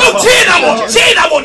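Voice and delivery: insistent shout. Real, not acted. Comic to groaning audience after a joke, insistent shout. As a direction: order the crowd to cheer.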